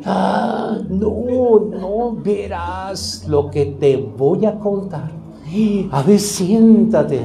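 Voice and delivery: slow, relishing gossip teller; eager gossip. Acted. Pastor imitates a gossiper inviting a listener, slow and relishing. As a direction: savour it like juicy news you can't wait to share.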